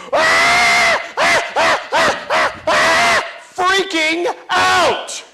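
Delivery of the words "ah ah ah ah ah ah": high, loud kindergarten scream; kids screaming. Retold or imitated. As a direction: shriek like a kid freaking out at recess. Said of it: "They just go".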